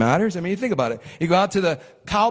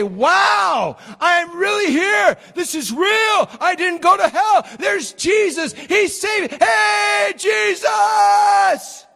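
high joyful shouting, slow at first; ecstatic shout; 12 semitones higher, 6 dB louder, much slower than usual. Pretend. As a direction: cry out in amazed joy. Imagined joy on arriving in heaven.

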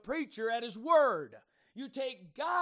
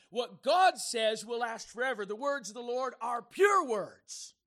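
fiery preaching; 6 dB louder than usual. Acted. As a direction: thunder it from the pulpit. Preacher rises to full force again.

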